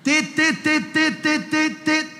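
rapid high monitor beeps. Retold or imitated. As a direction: beep like an alarm, high and fast. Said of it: "que Jesús te está llamando".

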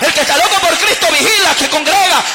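loud, driving, shouted exhortation; full-force preaching. Real, not acted. Preacher exhorts the congregation on being mad for Christ, full-force preaching. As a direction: build to a ringing shouted hallelujah.

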